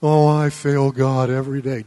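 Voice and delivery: sneering imitation of fake humility; mock humility. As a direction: parody a phony humble churchgoer. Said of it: "phony baloney humility statements".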